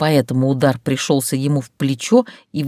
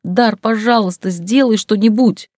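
higher tearful begging; tearful plea; 6 semitones higher, 4 dB louder than usual. Acted. Heroine in tears begs Dar, higher crying plea. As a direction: cry out for him to help. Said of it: "Из глаз весьма натурально брызнули слёзы".

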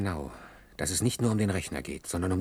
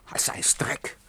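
geflüstert, voller Verachtung; verächtliches Flüstern. Acted. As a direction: haucht das Wort Dreck angewidert hin. (Hans flüstert Irma seine Verachtung zu)

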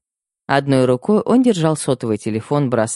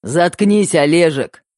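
bark; 5 semitones higher, 4 dB louder, slower than usual. A narrator's reading. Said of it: "рявкнул Мэтт".

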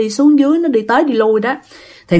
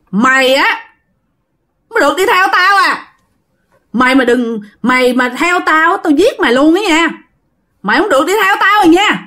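high-pitched, heated warning not to follow; menacing threat; 6 semitones higher than usual. Acted. Possessed child threatens the mother who followed, angry threat. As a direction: threaten her in a raised-pitch, insistent voice. Pointing at her, threatening to kill.

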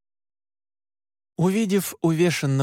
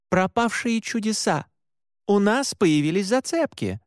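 patient repetition; 7 semitones higher than usual. A narrator's reading. Woman patiently repeating news of new leads, patient and higher-pitched. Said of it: "терпеливо повторила она".

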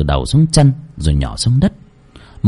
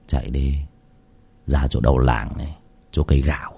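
low, much softer sly mutter; 9 semitones lower, 8 dB quieter than usual. Acted. Nam muttering slyly to himself as he walks off, low. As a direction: mutter it under your breath. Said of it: "mồm lẩm bẩm".

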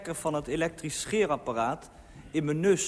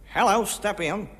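raised, higher-pitched invitation; calling out; 5 semitones higher, 4 dB louder than usual. A narrator's reading. Taxi passenger invites a man to step in, calling. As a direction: hail and wave a stranger aboard by voice. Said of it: "riep de passagier".

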